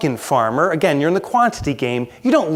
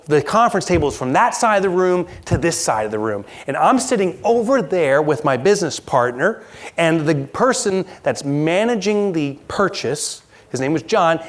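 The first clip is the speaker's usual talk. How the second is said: normal talking voice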